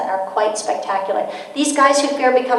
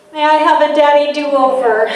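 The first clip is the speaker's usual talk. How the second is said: louder, contrite dad asking; sheepish dad; 3 semitones higher, 8 dB louder than usual. Retold or imitated. Father asking his child for a do-over. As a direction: ask for a do-over like an apologetic father. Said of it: "parent asking for a redo".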